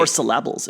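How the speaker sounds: "syllables" jokingly stressed as sil-LAB-uls; wrong-syllable stress. Pretend. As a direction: hit the middle of "syllables" on purpose. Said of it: "emphasize the right part of the syllable".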